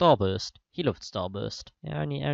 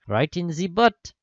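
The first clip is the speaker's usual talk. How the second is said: shouted line; 6 semitones higher, 6 dB louder, slower than usual. Acted. Reader voices charging spy.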